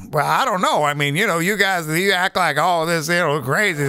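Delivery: lightly voiced evasive commentator, weaselly; a hint of a pundit dodging the question; Juan Williams impression. Mocking the TV pundit with a lightly put-on evasive voice. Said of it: "That's my Juan Williams impression".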